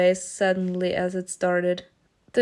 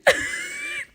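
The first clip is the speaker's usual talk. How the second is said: high laugh. Real, not acted. Laughing at Mimi's shopping.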